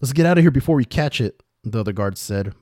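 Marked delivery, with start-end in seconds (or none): 0.0-1.4 high, loud alarm; alarmed guard's raised cry; panicked. A narrator's reading. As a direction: warn your partner and get out fast. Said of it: "Both of them left in a hurried panic".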